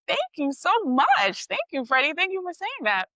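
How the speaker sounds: high-pitched, delighted retold thank-you; gushing thanks. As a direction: pour out flattered gratitude.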